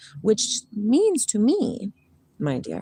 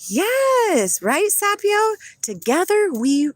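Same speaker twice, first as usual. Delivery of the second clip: much higher excited call; high cheery; 11 semitones higher, 4 dB louder, slower than usual. Real, not acted. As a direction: cheer the dog on brightly. Excited to dog.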